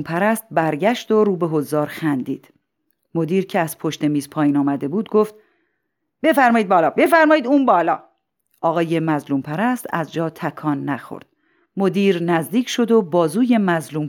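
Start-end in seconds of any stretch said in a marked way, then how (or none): 6.2-8.0 high, raised voice of a principal; calling out. Acted. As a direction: call him up to the podium insistently. Principal calls speaker to podium. (صدا زدن با صدای بلند)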